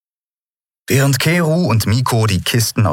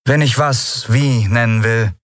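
Kapitänin, verwirrt; 5 semitones higher than usual. A narrator's reading. Andriel verwirrt.

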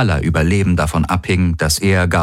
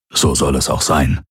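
grumbling; 4 semitones lower than usual. A narrator's reading. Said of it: "hatte Hox gebrummt".

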